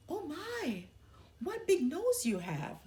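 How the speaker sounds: put-on a little girl marvelling at granny; Little Red, wide-eyed. Little Red talks to disguised wolf.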